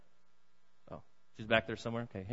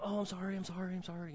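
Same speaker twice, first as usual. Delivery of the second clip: higher, softer apologetic murmur to a newborn; soothing apology to baby; 6 semitones higher, 5 dB quieter than usual. Retold or imitated. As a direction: coo sorry to the baby you just scratched. Grandpa soothing scratched newborn.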